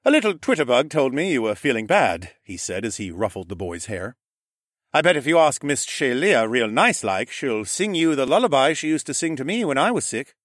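a lightly voiced roguish uncle; Horus's voice. A narrator's reading. Horus cheering up his sick nephew, lightly teasing. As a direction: tease the boy kindly.

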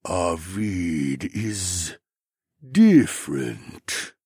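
very slow, stretched-out words; pensive slothman drone. A narrator's reading. Slothman describing his brother, pensive slow drone. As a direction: think aloud at a sloth's pace. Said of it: "he continued in a pensive tone".

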